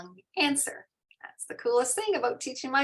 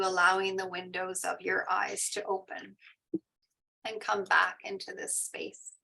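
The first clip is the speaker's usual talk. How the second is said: guided meditation. Acted. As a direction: gently bring the group back from meditation.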